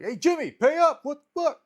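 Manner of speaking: high, loud shout demanding the money; retold yell. Kid yelling at Jimmy who owes him, loud high shout.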